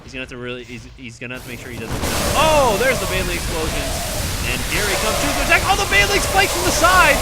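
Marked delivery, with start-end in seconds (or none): 2.4-7.2 loud, high shouting play-by-play; hype battle call. Real, not acted. Caster calling the Baneling explosions, loud high shout. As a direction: yell it as the fight breaks.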